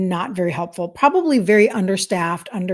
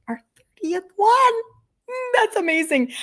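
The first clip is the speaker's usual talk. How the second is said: amazed echo; 12 semitones higher than usual. Acted. To viewers about her challenge, high mock-amazed echo. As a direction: marvel at the number in a put-on voice. Marvelling at her own count.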